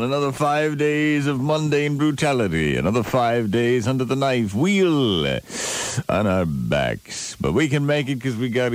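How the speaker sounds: low, slow DJ intro; radio DJ voice. Acted. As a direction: ease in like a morning DJ. Radio DJ patter.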